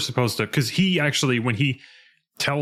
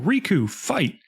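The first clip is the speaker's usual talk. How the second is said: mock yell; 8 semitones higher, slower than usual. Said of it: "the sound of the king yell".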